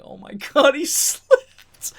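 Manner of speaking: high, cracking laugh through the words; high giggling disbelief. Retold or imitated. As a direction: laugh helplessly as you say it. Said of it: "I was laughing".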